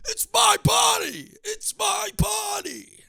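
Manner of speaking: high, slow shrieking yell of "it's my body"; mock scream. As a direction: screech it like a hammed-up tantrum. Mock outrage at a stranger.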